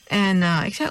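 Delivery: normal talking voice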